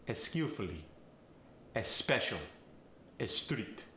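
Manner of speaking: eh sound added before skillfully, special and street; Farsi accent. Shown as a demonstration. Coach demonstrating a Farsi accent, eh-skillfully, eh-special, eh-street. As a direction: Farsi accent, a short eh in front of each S. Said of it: "Words starting with an S will often get the".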